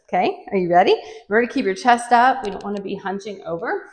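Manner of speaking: normal talking voice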